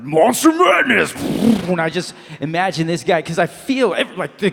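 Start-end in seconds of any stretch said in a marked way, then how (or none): 0.0-1.1 loud, high, drawn-out arena shout; mock truck-show announcer. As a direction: bellow it like a monster truck rally announcer. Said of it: "It's like".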